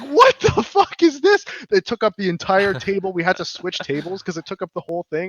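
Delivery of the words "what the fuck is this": high, slow shocked outburst; retold shock. Diner shocked by the huge pizza, high slow disbelief. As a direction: gape at the giant pizza. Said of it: "I was like".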